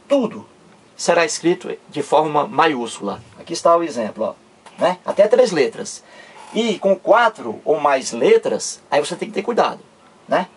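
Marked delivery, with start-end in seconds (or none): none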